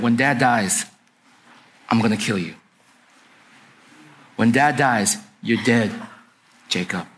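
low, controlled menace; low-pitched, deadly serious warning; menacing threat. Acted. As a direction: deliver a cold vow of revenge, with pauses. Esau vows to kill his brother.

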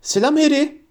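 high-pitched, eager schoolboy greeting; breathless excitement. A narrator's reading. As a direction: call out an excited, high hello. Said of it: "heyecandan soluk soluğa bir ses".

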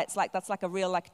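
normal talking voice

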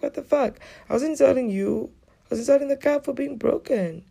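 high-pitched exasperation; retold incredulous comeback; indignant protest.

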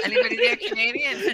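very high loud laughing question. Real, not acted. Streamer asking chat through laughter, very high and loud. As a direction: ask while laughing hard.

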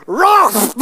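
loud, high comic imitation of a child's roar; mock battle cry, high and loud; mimicked roar. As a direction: roar like a small boy charging in, hammed-up. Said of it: "He goes".

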